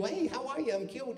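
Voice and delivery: a hint of an overly sweet charmer; mild mock cute; too cute Judd Hirsch. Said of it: "Judd Hirsch is a little too".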